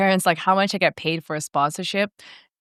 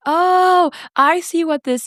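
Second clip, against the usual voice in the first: high, drawn-out dawning understanding; imitated "now I get it" reaction; acted-out realization; 11 semitones higher, 4 dB louder, much slower than usual. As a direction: voice parents suddenly catching on, stretched out.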